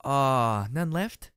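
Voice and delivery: playful letdown; disappointed whine. A narrator's reading. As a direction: sulk that it's over. Rampage disappointed, wants more fights.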